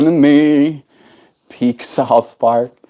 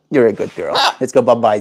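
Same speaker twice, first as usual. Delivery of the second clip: ordinary talk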